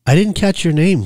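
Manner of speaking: lightly flirt, pitch lifted; flirtatious. A narrator's reading. She asks her handsome driver's name, flirtatious. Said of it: "she said flirtatiously".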